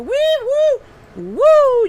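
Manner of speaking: high, loud whooping; hollering with excitement at the page; excited whoops. Real, not acted. Host reacting to a viewer's comment with loud whoops.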